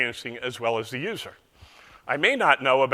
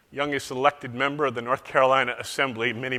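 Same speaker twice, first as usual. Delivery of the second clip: normal talking voice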